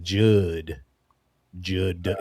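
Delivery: low "Judd", vowel pushed toward "Jood"; exaggerated regional pronunciation. Shown as a demonstration. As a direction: drop low on "Judd", imitating a Florida sheriff. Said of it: "That's how they say it".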